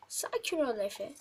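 usual speaking voice

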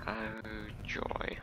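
a dry whispered joy; sarcastic whisper. Real, not acted. Driver sighs at the traffic, dry whispered sarcasm. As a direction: breathe the word out in mock delight.

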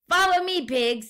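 high, loud rallying call; explorer's call. A narrator's reading. Guinea pig explorer calls to the other pigs, high and loud. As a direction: lead the pigs like a bold explorer.

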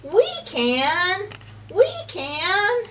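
high, bright eager call; eager answer. Acted. Fish answer the stuck duck, eager high call. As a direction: cheer it out as helpers.

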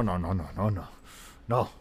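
ordinary delivery

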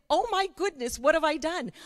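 high, loud, panicked gasp at herself; suddenly realising the task is too big; mock panic. Speaker recalling her own panic, high and loud mock alarm. Realising she must write a cookbook.

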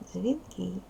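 ordinary delivery

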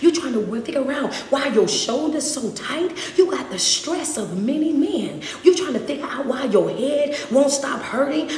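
ordinary talk

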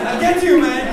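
sudden high loud playful threat; jokey shouted threat; mock threat. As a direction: pounce on the word get. Mock threat at audience member.

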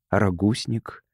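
ordinary delivery

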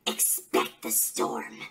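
slow, very low near-whisper; dark, breath-heavy villain tagline; sinister sign-off. Acted. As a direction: sink into a hushed, drawn-out warning. Promo's closing threat.

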